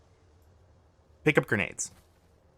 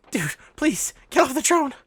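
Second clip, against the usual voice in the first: pleading like he can't bear to watch; exasperated plea; 13 semitones higher than usual. Real, not acted. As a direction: pitch jumps way up, begging the player. Coach pleading with player on screen.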